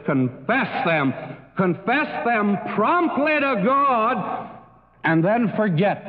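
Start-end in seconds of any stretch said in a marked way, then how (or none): 1.6-4.5 higher and drawn out, hitting promptly; slow and rising on promptly, urging; promptly stretched high and slow; preaching emphasis. Real, not acted.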